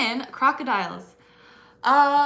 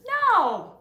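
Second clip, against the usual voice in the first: high, loud Aussie "no"; Aussie accent; 11 semitones higher, 11 dB louder than usual. Pretend. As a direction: exaggerate the Australian vowel, loudly. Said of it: "was that an Aussie accent".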